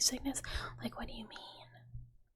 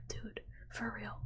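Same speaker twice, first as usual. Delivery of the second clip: usual speaking voice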